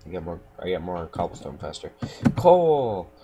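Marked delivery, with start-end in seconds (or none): 2.4-3.1 sudden high, loud cry of triumph; excited shout. Real, not acted. Player spotting coal, loud and high excited. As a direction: yell it out like striking gold. Excited find while mining.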